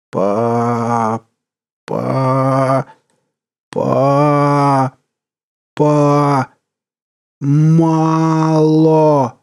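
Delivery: robotic stutter building to a shout; a glitching doll growing hungry; mechanical stutter. A narrator's reading. Monster girl stuttering, then demanding.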